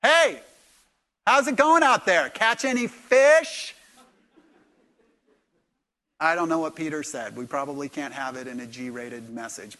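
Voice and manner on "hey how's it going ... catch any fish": loud, high call across the water; shouted, drawn-out hail from shore; yelling out. Acted. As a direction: holler to fishermen far out. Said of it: "And he yells out".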